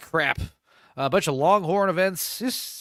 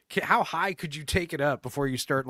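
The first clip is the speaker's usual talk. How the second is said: ordinary talk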